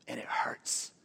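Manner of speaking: soft whispered ache. Acted. Preacher to hurting teens, whispered. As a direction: breathe it like a secret hurt. Speaking to struggling kids.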